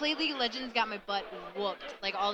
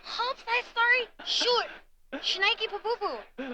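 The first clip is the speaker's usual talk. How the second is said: flustered silly voice; 11 semitones higher, slower than usual. Retold or imitated. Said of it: "I'm like".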